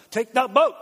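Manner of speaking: loud, high, punched-out order; hammed-up shout at stubborn listeners; exasperated insistence. Jesus losing patience with refusing disciples, exasperated mock shout. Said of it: "Jesus insisted".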